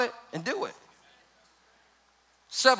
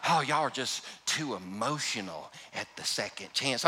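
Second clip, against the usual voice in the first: snooty critic; 6 semitones lower, 9 dB quieter than usual. Retold or imitated. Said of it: "I'll run into somebody like".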